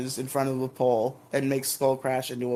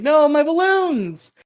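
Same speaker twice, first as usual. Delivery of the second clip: very high, slow anguished cry; high hammed-up despair; mock wail; 12 semitones higher, 3 dB louder, slower than usual. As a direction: wail over lost balloons. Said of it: "Kim's like".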